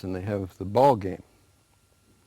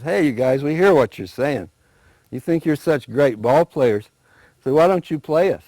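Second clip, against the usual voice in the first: loud, raised challenge; challenging eagle; 5 semitones higher, 7 dB louder than usual. Acted. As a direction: call them out.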